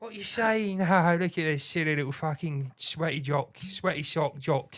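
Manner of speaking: mock a sweaty jock in a cackling English voice. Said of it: "Sounded like [name] there".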